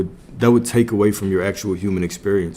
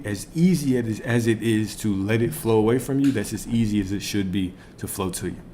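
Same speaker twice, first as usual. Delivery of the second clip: ordinary delivery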